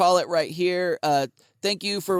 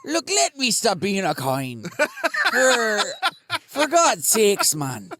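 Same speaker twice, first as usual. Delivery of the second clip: lightly Scottish devil stuck as a coin, pleading; a hint of Scottish brogue, begging to be let out; Scottish accent. Satan trapped in Jack's pocket pleads, mild Scottish accent. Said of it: "Going slipping into Scottish".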